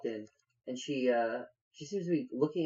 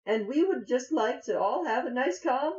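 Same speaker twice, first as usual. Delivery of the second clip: high, measured halfling voice; pleading halfling; 11 semitones higher, 3 dB louder than usual. Acted. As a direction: talk down an armed stranger, high-pitched. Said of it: "She says".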